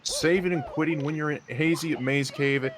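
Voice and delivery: excited play-by-play. Real, not acted. Commentator calling a runner's costly save and quit to viewers, excited. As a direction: call the upset with rising urgency.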